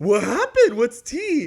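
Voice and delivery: loud, high, hungry for the tea; loud mock eager gossip hunt. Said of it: "So I was like".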